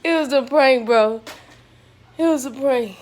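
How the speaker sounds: loud laughing yell; shouting. Real, not acted. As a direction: shout it out over the chaos. Yelling after prank wakes him.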